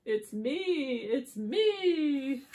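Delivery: lightly voiced cow, drawn-out words; a hint of an excited cow; happy cow. Cow reveals itself under the mask, mildly excited. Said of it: "says the cow".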